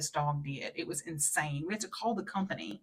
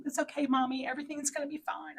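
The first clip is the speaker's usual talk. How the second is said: put-on comforting kid; 8 semitones higher than usual. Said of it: "and Mel".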